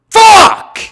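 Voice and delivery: sudden scream, very high and loud; furious shout. Real, not acted. Gamer screaming as his man gets injured, raging. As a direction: explode in rage.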